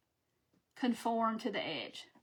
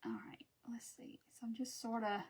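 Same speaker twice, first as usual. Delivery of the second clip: whispered to herself while working; whisper, focused on the task; 12 dB quieter than usual. Real, not acted. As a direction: murmur under breath while concentrating. Concentrating on work.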